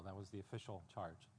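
ordinary talk

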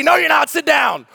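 loud, high playful scolding with a laugh; mock scolding. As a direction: bark it out half-laughing. Preacher acts himself stopping them.

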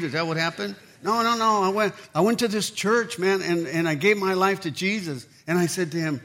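ordinary talk, nothing marked

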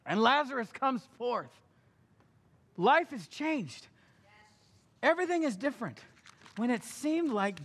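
high, loud proclamation; triumphant preaching. Acted. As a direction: announce the miracle.

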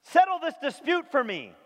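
high, loud call from the crowd; a man in a crowd yells to Jesus, loud and high; yelling out. Retold or imitated. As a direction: shout it over the crowd to get his attention. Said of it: "somebody yells out in the crowd".

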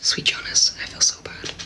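whispered guilty aside; hushed, guilty whisper. Real, not acted.

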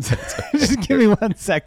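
laughing while apologizing. Real, not acted. Flustered guest laughing apologetically.

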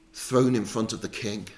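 ordinary delivery

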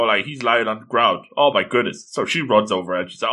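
normal talking voice

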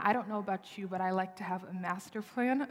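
normal talking voice

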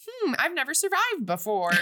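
light mock astonishment; puzzled wonder. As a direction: marvel at being alive. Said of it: "Shen is like".

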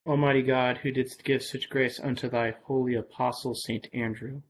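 ordinary talk

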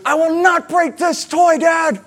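put-on very high, loud childish yell; child's shout. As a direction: shout it like a defiant four-year-old. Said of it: "She goes".

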